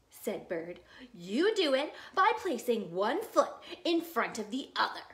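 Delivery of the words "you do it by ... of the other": loud, higher, heavily punched explanation; sarcastic grouch. Acted. Bird giving Beaver a hint, loud exasperated sarcasm. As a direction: spell it out with exaggerated swoops.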